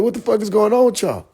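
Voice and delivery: high mock rant at the elder; high outraged mock shout. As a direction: go up high in disbelief. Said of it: "He said".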